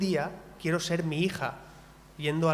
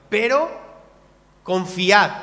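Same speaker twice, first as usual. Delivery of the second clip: calling out the word confiad loud and ringing; loud call to trust; 3 semitones higher, 8 dB louder, slower than usual. Acted. Preacher quoting Jesus to the church, calling out loud. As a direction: proclaim confiad at full voice.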